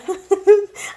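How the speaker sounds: a high sheepish laugh; embarrassed laugh. Real, not acted. Reader laughing at her own chapter mix-up. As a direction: giggle it off.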